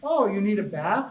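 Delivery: mock cutesy baby-talk scolding. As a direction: imitate a friend's playful cooing, swooping pitch. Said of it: "talking in this really cutesy voice".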